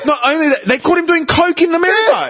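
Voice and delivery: high, laughing near-shout of disbelief; incredulous outrage. Real, not acted. Listing a player's scandals to mates, incredulous and laughing. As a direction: pile on the scandal, pitch soaring.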